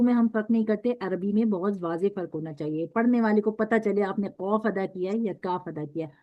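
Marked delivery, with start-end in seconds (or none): none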